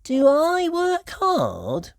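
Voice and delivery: high, slow wondering; Peppa's question. A narrator's reading. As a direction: ask hopefully. Peppa asks.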